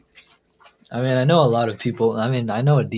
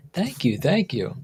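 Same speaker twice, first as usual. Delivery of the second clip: higher, slow, gratified thank you; pleased thanks; 7 semitones higher than usual. Real, not acted. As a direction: accept the praise with delight. Thanking viewer for compliment.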